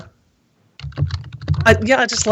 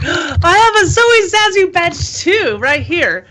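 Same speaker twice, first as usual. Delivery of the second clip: excited gasp; 8 semitones higher, slower than usual. Real, not acted. Gasps at matching badge.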